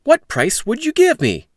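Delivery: put-on high, bright young Jack; polite boy Jack. Jack telling the stranger his errand, high and polite. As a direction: answer like an eager polite boy. Jack's voice.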